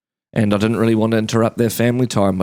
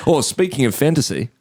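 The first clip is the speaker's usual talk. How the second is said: usual speaking voice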